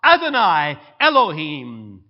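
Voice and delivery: loud, slow cry of worship; loud awed confession. Retold or imitated. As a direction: cry it out overwhelmed by Christ's presence. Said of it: "he cries out".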